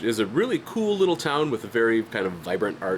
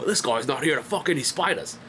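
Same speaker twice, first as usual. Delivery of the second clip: imitated Aussie bloke, pitched up; put-on Australian voice, a bit higher; Australian accent; 5 semitones higher than usual. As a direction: quote it in a broad Australian accent. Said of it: "my favorite Australian phrase".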